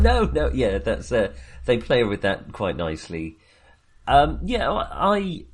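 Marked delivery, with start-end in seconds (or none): 0.0-0.5 Dracula's refusal. Retold or imitated. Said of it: "And he's like".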